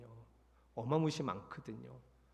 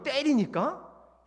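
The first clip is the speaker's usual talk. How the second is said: incredulous; 9 semitones higher, 6 dB louder, much slower than usual. Retold or imitated. Incredulous repeat of scolding.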